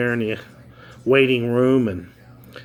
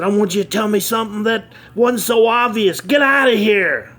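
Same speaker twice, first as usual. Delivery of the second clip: higher, louder furious outburst; angry blow-up; 10 semitones higher, 5 dB louder than usual. Acted. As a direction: blow up at the kid, yelling. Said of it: "the C E O blew up".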